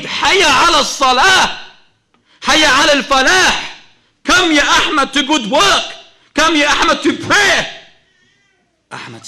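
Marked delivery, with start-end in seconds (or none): 0.0-7.6 loud, high call to prayer; announcer's proclamation. Acted. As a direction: proclaim it with full force as the caller. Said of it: "when the announcer proclaimed".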